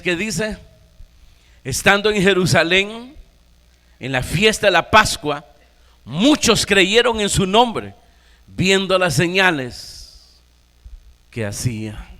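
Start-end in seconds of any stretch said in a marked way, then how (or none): 1.6-10.4 booming pulpit reading of the verse; preaching at full force. Acted. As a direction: preach it out to the congregation. Preacher proclaims scripture loudly.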